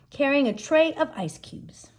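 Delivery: plain reading voice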